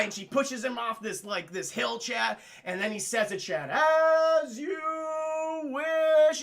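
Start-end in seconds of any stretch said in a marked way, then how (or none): 3.7-6.4 high, loud, drawn-out call; hammed-up calling out; long falling-away cry. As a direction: stretch each word as if tumbling away. Acted cry while tumbling down hill.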